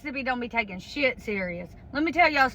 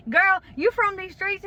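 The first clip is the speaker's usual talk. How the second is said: excited praise; 8 semitones higher than usual. Retold or imitated. Willie hyping her up.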